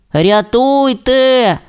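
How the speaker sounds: high, slow drawn-out help call; cry for help. A narrator's reading. Rasmus stuck in the swamp calls high and slow for help. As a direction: wail for rescue from the swamp. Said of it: "кричав Расмус".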